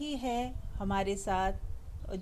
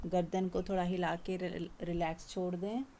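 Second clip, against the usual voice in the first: soft, gentle relaxation guidance; soft meditation voice; 2 semitones lower, 4 dB quieter than usual. Acted. Teacher easing the group's bodies, soft guided meditation. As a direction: let the words settle them down.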